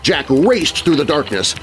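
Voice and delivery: usual speaking voice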